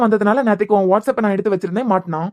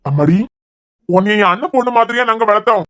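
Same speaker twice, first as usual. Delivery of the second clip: stern demand; 4 semitones higher than usual. Acted. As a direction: demand the apology firmly. Uncle demands an apology. (மன்னிப்பு கேட்கச் சொல்லி கண்டிப்பான உரத்த குரலில்)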